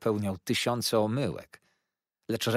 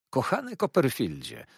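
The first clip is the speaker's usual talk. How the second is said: fond call; 5 semitones higher than usual. A narrator's reading. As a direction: greet him warmly across the room. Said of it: "zawołał".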